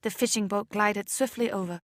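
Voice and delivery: usual speaking voice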